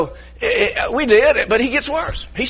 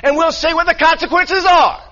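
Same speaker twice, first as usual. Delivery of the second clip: higher, raised-voice mock of the other man's retort; guide yelling back; 8 semitones higher, 4 dB louder than usual. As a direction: throw the guide's defiant yell back. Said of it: "And he says".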